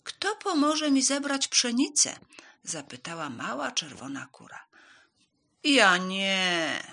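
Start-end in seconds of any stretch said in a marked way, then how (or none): 0.0-2.2 high, loud storybook call; raised, questioning hen voice; hen calling for helpers. A narrator's reading. Hen calls for help. 5.6-6.8 loud, drawn-out dog refusal; loud storybook dog; called-out refusal. A narrator's reading. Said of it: "zawołał brązowy pies".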